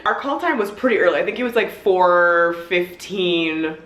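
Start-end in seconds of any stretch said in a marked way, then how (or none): 1.7-3.8 very slow, stretched "four fifteen"; drawn-out emphasis. Real, not acted. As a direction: linger on each word of "four fifteen". Stretches out early call time.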